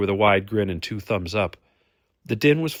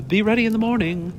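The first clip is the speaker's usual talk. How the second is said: very high, louder blurt; awkward afterthought; 13 semitones higher, 5 dB louder than usual. A narrator's reading. Vagelin adds an order on leaving, awkward high blurt. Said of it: "She awkwardly added".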